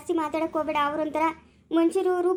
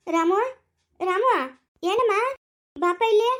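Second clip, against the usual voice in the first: calling out; 4 semitones higher, 4 dB louder, slower than usual. Acted. Mother calls her son over.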